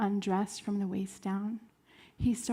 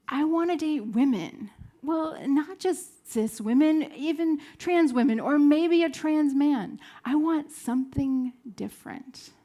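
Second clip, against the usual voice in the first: higher, louder, building list of wants; eager declaration; 5 semitones higher, 5 dB louder than usual. Retold or imitated. Telling her friend whom she wants to date, eagerly building. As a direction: build up louder through each option.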